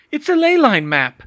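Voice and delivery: put-on frightened young woman. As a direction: answer fast, scared. Hermione voiced, pinned down.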